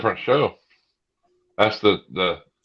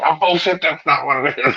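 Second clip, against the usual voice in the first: scoffing disbelief; 9 semitones higher than usual. Retold or imitated. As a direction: call bullshit on him, high-pitched. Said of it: "you're gonna go".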